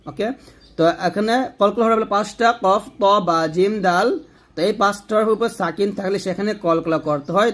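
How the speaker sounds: normal talking voice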